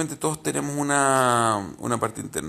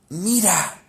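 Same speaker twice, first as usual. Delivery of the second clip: higher, louder teasing Mira; tempting inner voice; 6 semitones higher, 5 dB louder than usual. Retold or imitated. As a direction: voice the body's urge pulling at you. (voz interior tentadora, más aguda)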